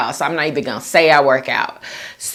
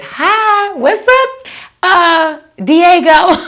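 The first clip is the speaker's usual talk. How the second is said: much higher, cutesy hello; high squeaky greeting; 13 semitones higher, 4 dB louder, much slower than usual. Retold or imitated. Reads viewer greeting in voice.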